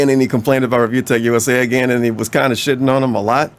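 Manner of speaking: ordinary delivery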